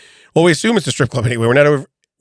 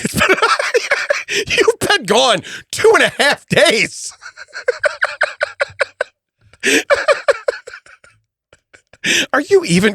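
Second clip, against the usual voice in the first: laughing while talking; 12 semitones higher than usual. Real, not acted. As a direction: gasp it out laughing. High laughing protest.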